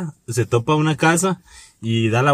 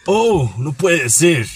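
slow soap-opera disbelief; hammed-up shock, drawing out every word; telenovela voice; much slower than usual. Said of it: "una voz de novela".

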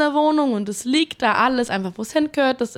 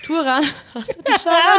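asked through laughter, higher; laughing question; 6 semitones higher, 4 dB louder than usual. Real, not acted. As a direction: giggle out the question. Laugh heard.

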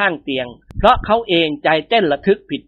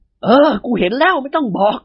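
higher, weighty, each word pressed down; heavy emphatic voice; 7 semitones higher than usual. Acted. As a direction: cut off the pest firmly. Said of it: "กล่าวขึ้นด้วยเสียงหนักๆ". (เสียงหนักแน่นตัดบท)

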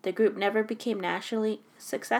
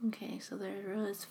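whispered under her breath, unvoiced and much softer; 16 dB quieter than usual. Real, not acted. Friend whispering to herself while thinking over the answer. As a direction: mutter it to yourself as you puzzle it out.

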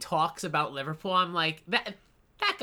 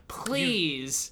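ordinary talk, nothing marked

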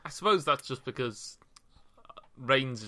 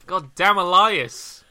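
mock-exasperated curse; 4 semitones higher, 11 dB louder, slower than usual. Real, not acted. Reacting to heel attack.

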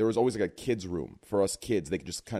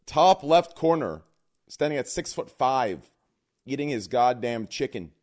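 loud ring announcer intro; 5 dB louder, slower than usual. Acted. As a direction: hype up each guest like a boxing announcer.

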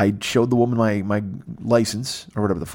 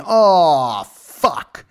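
louder, higher oh of realization; sudden dismay; 6 semitones higher, 5 dB louder than usual. Retold or imitated. Retelling the moment at the conveyor belt, louder sudden dismay. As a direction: catch yourself in horror.

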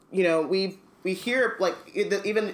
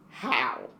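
lower, understated cold drawl; a coolly drawled question, pitched down; 4 semitones lower than usual. A narrator's reading. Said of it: "said a cold, drawling voice".

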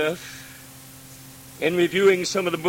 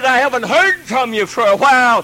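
high, loud pleading call; God calling out loud for his missing children; lonely God crying out; 7 semitones higher, 7 dB louder than usual. Acted. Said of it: "his lonely voice cries out and says".

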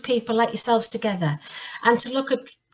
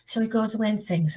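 ordinary delivery

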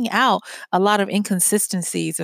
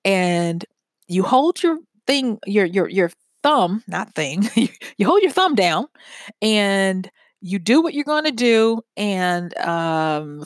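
ordinary delivery

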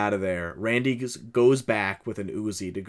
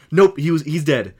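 hurried shout; 6 semitones higher, 9 dB louder than usual. Retold or imitated. Derek running back retold.

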